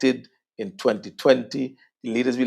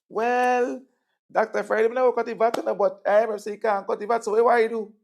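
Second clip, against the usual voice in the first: voicing a questioner; 11 semitones higher, 3 dB louder than usual. Public voiced questioning the opposition.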